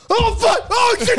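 freaking out at sudden danger; hammed-up high panic; panicked driver. Driver sees gauntlet.

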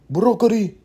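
high mock Chinese accent. Comedian showing the accent he finds funny, mock Chinese accent. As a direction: blurt it in a caricatured Chinese accent. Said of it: "Chinese is a funny fucking accent".

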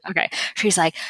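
ordinary talk, nothing marked

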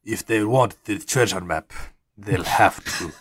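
low-voiced card player, accented; gambler with an accent. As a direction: drop low and measured, with a put-on accent.

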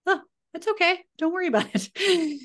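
lightly voiced little boy brushing it off; imitating her son. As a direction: mom playing her son's easy reply. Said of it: "he's just like".

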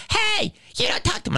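very high-pitched mocking imitation; indignant scolding. Staffer behind the counter scolding the customer, indignantly. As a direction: squeal out a mock reprimand. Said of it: "He talked to me like that".

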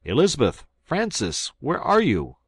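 high, loud anxious calls through the house; calling aloud. Acted. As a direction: call out for the missing family. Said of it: "I called aloud".

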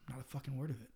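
very soft secretive murmur; hushed confession. Retold or imitated. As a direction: confide it under your breath. Retold whispered confession during hug.

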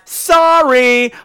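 "sorry" punched high and loud; capitalized sorry. Retold or imitated. Reading the studio's email, capital "sorry" stressed. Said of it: "took the time to capitalize and italicize".

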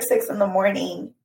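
ordinary delivery

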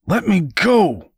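high, drawn-out cry to be released; shout. A narrator's reading. Boy gripped by the arm, high shout. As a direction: shout it high and slow, struggling free. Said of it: "Woodrow shouted".

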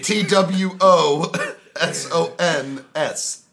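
slow, loud letter-by-letter spelling; spelled out slowly. Shown as a demonstration. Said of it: "spelling out to correct".

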